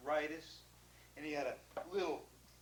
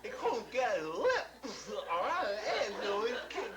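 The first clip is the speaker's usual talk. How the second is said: big false teeth; 4 semitones higher than usual. Retold or imitated. Zeal with teeth too big, lips stretched. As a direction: mouth full of oversized teeth, giggling through. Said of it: "he sounded like this".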